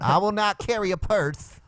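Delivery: high, lisping mock convert; put-on campy testimony voice.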